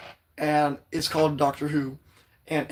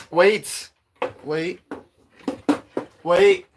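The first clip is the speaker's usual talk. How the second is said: louder, higher rising waits; excited wait; 3 semitones higher, 4 dB louder, much slower than usual. Real, not acted. Streamer making her viewers hold on, louder rising waits. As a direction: hold everyone in suspense, pitching up.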